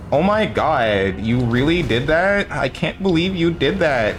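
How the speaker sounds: put-on slow, rising Valley Girl gush; Valley Girl accent. Shown as a demonstration. Gamer friend demoing a Valley Girl accent, slow and rising. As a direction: play the shocked Valley Girl, drawn out. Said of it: "kind of accent".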